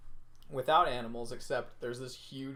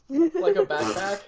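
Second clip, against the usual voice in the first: amused disbelief; 13 semitones higher, 9 dB louder than usual. Real, not acted. Amused disbelief at dog backpack.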